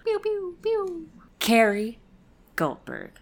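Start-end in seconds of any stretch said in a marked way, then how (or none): 0.0-1.1 high pew sound effects. Pretend. Sound effects after patron thanks.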